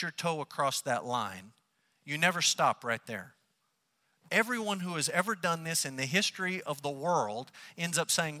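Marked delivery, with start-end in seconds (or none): none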